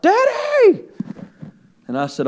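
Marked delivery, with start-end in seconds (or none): 0.0-0.8 put-on high, drawn-out child's holler; daughter hollering. Daughter in the backyard hollering to her father, imitated high holler. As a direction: imitate a child calling out for a parent. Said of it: "my daughter began hollering out".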